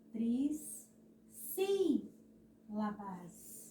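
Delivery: tris, pause, SÍ, pause, labas for trissílabas; força no acento. Shown as a demonstration. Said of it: "Por que que a tia tá fazendo essa, essa força". (trissílabas, devagar, com força no SÍ)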